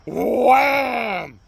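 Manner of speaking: loud wham; punch sound effect. Retold or imitated. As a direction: hit the word like a punch. Punch in the story.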